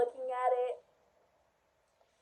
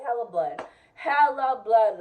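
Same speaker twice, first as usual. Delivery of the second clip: normal talking voice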